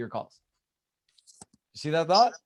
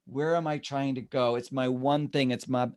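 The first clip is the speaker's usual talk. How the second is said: usual speaking voice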